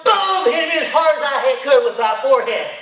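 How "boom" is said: loud, high punch of a word; headbutt boom. Acted. Storyteller headbutting the dinosaur, a loud high boom. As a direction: slam it out like the impact.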